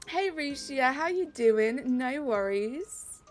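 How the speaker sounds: ordinary talk, nothing marked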